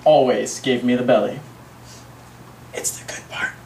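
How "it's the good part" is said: hushed, whispered aside; very soft, voiceless confidence; confidential whisper. Acted. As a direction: whisper it like a secret.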